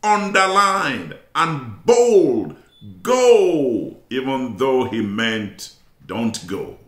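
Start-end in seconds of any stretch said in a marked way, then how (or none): 0.0-4.0 drawn-out, higher, emphatic GO; bold capital letters. Acted. Preacher voicing God's answer in block capitals, loud and emphatic. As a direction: say it big, underlined and bold. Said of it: "in block letters or in capital letters".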